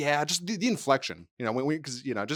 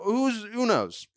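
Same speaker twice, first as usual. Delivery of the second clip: slow shrug; 8 semitones higher, much slower than usual. Real, not acted. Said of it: "drawn-out shrug".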